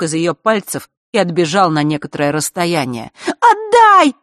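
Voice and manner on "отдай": shrill high yell. Acted. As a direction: scream at him to give it back. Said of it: "завопила Захара".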